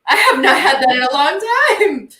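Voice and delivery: loud, high, laughing exclamation; delighted exclamation. Retold or imitated. Woman reacting to her seat being pulled out, delighted. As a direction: blurt it out, thrilled by the treatment.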